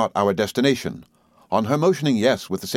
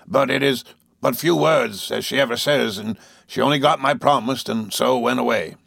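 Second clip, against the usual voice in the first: a hint of a rough Yarmouth boatman; rustic old sailor; 2 semitones higher than usual. A narrator's reading. Peggotty voiced in the story.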